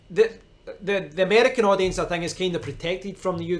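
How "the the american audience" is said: usual speaking voice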